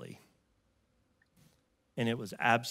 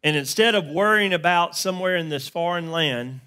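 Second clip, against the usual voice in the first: louder, higher sermon drive; building preaching; 6 semitones higher, 9 dB louder than usual. Acted. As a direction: lift the story into preaching. Preaching rises again.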